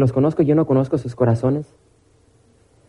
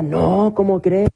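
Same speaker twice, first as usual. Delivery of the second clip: higher-pitched, shocked-sounding woman; mock-scandalized denial; 6 semitones higher, much slower than usual. Woman asked if she desired a man, mock-scandalized denial. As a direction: play her affronted, pitch climbing. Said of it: "Pero le dije".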